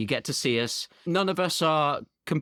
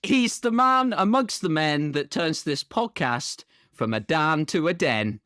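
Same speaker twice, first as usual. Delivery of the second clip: podcast announcer; 3 semitones higher, slower than usual. Acted. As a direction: announce the last guest with big mock grandeur. Announces cast member.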